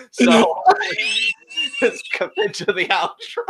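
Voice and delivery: high, laughing through the words. Real, not acted. Host steers back to the outro, laughing. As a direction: try to go on while cracking up. Host cracking up after outburst.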